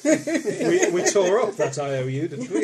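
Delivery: laughing. Real, not acted. Laughing banter.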